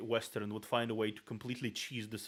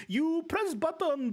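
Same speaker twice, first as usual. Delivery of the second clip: high, slow put-on nagging voice; mock parent voice; 5 dB louder, slower than usual. Streamer mocking an old-timer's complaint, high put-on voice. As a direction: squeak it out like a fussy elder.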